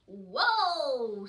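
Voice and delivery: big loud whoa of wonder. Acted. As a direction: shout it in amazement. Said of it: "said Poots".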